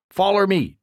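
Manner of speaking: a hint of a rough country man leading the way; lightly voiced Duke. Duke leads up ladder.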